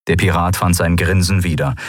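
ordinary delivery

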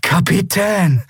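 high, drawn-out cry of relief; long, high young voice; relieved. A narrator's reading. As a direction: breathe out the title in relief. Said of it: "hörte ihn tief ausatmen".

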